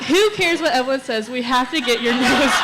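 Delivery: loud, high mock outrage breaking into laughter; insistent urging. Retelling pushing a hesitant friend into a piercing, loud laughing insistence.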